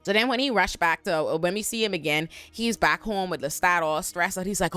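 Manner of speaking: normal talking voice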